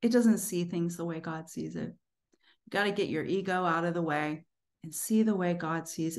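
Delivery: ordinary delivery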